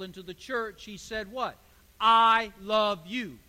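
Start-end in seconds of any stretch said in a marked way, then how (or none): 2.0-3.4 slow, loud declaration of love; voicing God, slow and loud. Preacher voicing God to his people, slow and loud. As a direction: say it slowly and firmly, as God. God's words voiced in a sermon.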